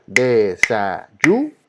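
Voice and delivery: Desayuno slowly syllable by syllable, YU lifted; stress on YU. Shown as a demonstration. Teacher making students listen, slow Desayuno with stressed YU. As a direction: draw out Desayuno and raise the yu. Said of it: "Hay que escuchar".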